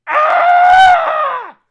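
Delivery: a high, loud yell of frustration; frustrated scream. Real, not acted. Reviewer screaming at the show's nonsense, high and loud. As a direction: scream out the exasperation.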